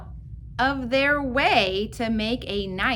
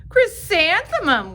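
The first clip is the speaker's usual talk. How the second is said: mocking girls; 7 semitones higher, 8 dB louder than usual. Girls answer teacher, mocking.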